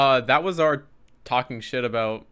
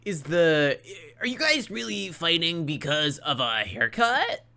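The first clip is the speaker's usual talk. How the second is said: slightly higher, slow incredulous question; TV host questioning; 5 semitones higher, slower than usual. Retold or imitated. Reunion host asking the two feuding women, slow incredulous question. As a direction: imitate a puzzled host, drawn out. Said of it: "Andy's, like, asking questions".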